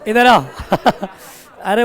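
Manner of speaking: ordinary delivery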